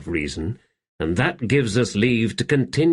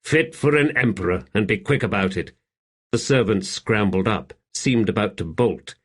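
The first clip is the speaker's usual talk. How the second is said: ordinary delivery, nothing marked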